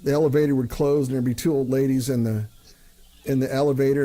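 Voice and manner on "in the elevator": ordinary talk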